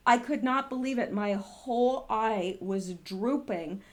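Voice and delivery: ordinary talk, nothing marked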